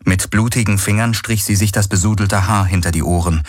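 ordinary delivery

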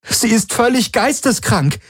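high-pitched, outraged exclamation; appalled outcry. A narrator's reading. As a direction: cry it out in disbelief, voice high. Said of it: "rief Kirai". (entsetzter Ausruf mit hoher Stimme)

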